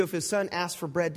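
usual speaking voice

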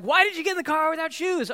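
mock disbelief at a shoeless kid; exasperated parent.

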